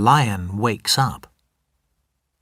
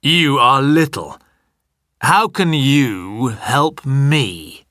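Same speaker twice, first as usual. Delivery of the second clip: a hint of a big lion scoffing; lion amused by the tiny mouse, slow; mocking lion. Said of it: "it says".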